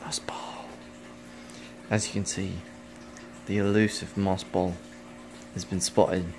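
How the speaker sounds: bad Australian nature-documentary accent; hushed fake Australian wildlife host; lightly done Australian wildlife presenter; crappy Australian accent. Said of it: "this really crappy Australian accent".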